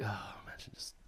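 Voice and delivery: hushed, breathy aside; mock whisper. Mocking a mate's rude call in a whisper. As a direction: whisper it like you're appalled.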